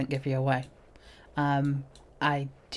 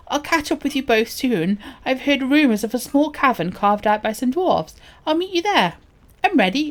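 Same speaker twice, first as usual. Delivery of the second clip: animated, higher-pitched quest-giver voice; lightly acted dialogue, higher than usual; quest character; 7 semitones higher, 8 dB louder than usual. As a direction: read it as a lively game character.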